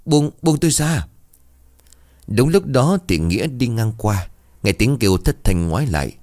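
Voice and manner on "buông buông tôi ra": high, panicked plea to let go; a narrator's mild sob-scream, pitched high; crying out. A narrator's reading. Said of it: "vừa la vừa khóc".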